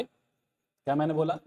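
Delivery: usual speaking voice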